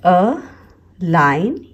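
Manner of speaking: अ, then line, with a clear gap; two separate teaching beats, अ and line; split into syllables. Shown as a demonstration. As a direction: break it apart as अ - line. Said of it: "ये दो syllable word है".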